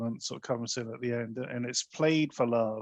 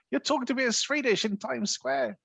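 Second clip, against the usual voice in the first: much higher mock-irritated brush-off; exasperated New Yorker; 12 semitones higher than usual. Retelling the street scene, a passer-by shoos him off in a high put-on voice. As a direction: fob off a pestering stranger. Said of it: "he's going".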